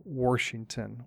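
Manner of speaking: WASHINGTON pronounced Warshington; intrusive R. Shown as a demonstration. Explaining a regional habit, Washington with an added R. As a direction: stick an R before the SH in Washington. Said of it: "stick in that R".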